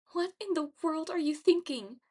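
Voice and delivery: high-pitched, flustered disbelief; flustered heroine voice. A narrator's reading. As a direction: ask it flustered, voice pitched right up. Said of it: "She sounded pretty flustered".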